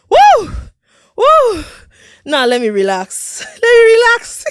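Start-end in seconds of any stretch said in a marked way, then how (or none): 0.1-1.6 loud high woo cheers; excited whoops. Real, not acted. Host celebrating her episode launch with loud whoops. Hyping herself up. 3.5-4.4 laughing, higher and louder. Real, not acted. Host laughing at her own excitement, higher and louder. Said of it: "I don't want to hype myself".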